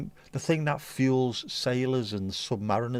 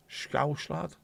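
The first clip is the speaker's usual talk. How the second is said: put-on Scouse accent; 3 semitones higher, 4 dB quieter than usual. Mock Scouser after scouse talk.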